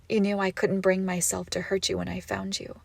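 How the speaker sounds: ordinary talk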